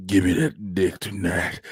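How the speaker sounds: very low, slow and soft; mock seductive voice. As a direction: imitate a partner's deep come-on. Imitates partner in the low voice.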